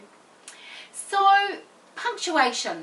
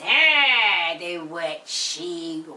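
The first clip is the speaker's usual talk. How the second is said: witch voice; 8 semitones lower, 4 dB louder, much slower than usual. Acted. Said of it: "a witch that goes".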